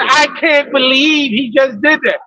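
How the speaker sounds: slow, put-on weepy whine; mock tearful disbelief. Woman left behind by her date, mock tearful disbelief. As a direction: imitate her wailing in disbelief.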